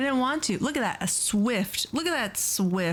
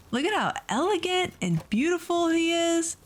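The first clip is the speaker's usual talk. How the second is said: admiring coo; 9 semitones higher than usual. Real, not acted. Admiring her new pal.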